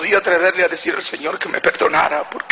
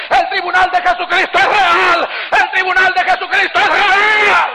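higher, louder proclamation shouted to the congregation; preaching shout; 10 semitones higher, 4 dB louder than usual. Acted. Preacher proclaims the tribunal is real, shouting. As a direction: hammer the claim home like a fiery preacher.

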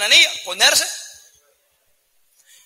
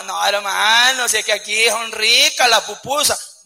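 drawn-out excuse; slower than usual. Acted. Brother's slow innocent excuse.

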